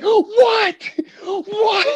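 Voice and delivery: high, mock-exasperated yelling; exasperated Tom Hanks. Owner runs out to barking dog, exasperated yell. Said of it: "Tom Hanks runs out there".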